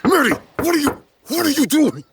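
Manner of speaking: high, loud panicked call to Maddie; alarmed shout. Real, not acted. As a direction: try to stop her before she knocks. Maddie goes to knock.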